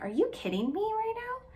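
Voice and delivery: high, disbelieving cry; incredulous outburst. Retold or imitated. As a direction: retell shocked disbelief at the cop. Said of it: "I was like".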